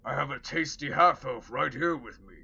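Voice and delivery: a scheming orc offering food. Acted. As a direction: Rollo faking an orc voice to tempt the orcs. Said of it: "in his orc voice".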